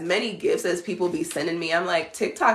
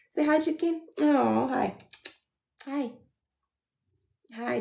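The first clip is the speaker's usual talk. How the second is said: pet-talk voice; 8 semitones higher than usual. Real, not acted. Owner greeting her dog in high baby talk. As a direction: coo hello to the pup.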